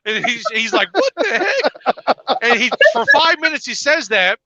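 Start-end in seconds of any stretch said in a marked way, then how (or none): 0.9-2.4 high, slow, drawn-out disbelief; exaggerated retold incredulity; mock bafflement. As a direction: imitate a baffled husband, pitched way up. Retold baffled husband reacting to wife.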